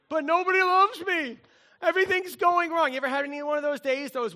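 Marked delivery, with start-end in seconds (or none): 0.1-2.9 loud, higher mock wail; hammed-up whine. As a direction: wail it like a melodramatic sulk. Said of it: "worm song".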